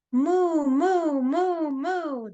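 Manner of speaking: high playful mooing; cow mooing. Pretend. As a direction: moo like a cartoon cow. Cow sound in kids' rhyme.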